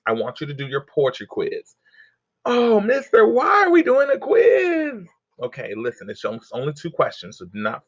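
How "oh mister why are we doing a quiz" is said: put-on high, pleading kid's complaint; whiny student voice. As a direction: whine it like a worried student. Voicing a student's whiny question.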